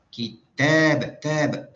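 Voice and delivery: "Kitab" with "tab" high, loud and stretched; stress on tab. Shown as a demonstration. Teacher shows students where tanghim falls, "tab" stressed hard. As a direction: hammer the long second syllable "tab". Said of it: "Aina tanghim?".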